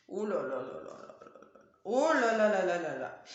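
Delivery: choking after swallowing wrong; coughing voice, drink went down wrong; swallowed the wrong way. Real, not acted. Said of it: "j'ai avalé de travers". (qui s'étrangle après avoir avalé de travers)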